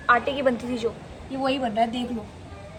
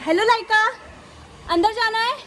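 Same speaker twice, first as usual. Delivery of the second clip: higher, sing-song voice for the dog; pet talk; 8 semitones higher than usual. Real, not acted. Greeting her dog Laika at the door, higher pet voice. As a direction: coo at the dog.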